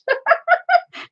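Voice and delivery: a high, bright burst of laughter; high amused laugh. Real, not acted. Streamer laughing at a viewer's Irish guess, high laugh.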